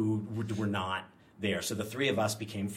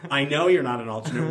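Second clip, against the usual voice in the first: louder, laughing eye-roll tone; mock-exasperated boss, loud with a laugh; 4 semitones higher, 10 dB louder than usual. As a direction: say it like you're rolling your eyes. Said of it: "rolled his eyes and goes".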